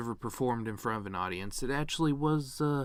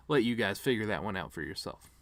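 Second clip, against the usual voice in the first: ordinary talk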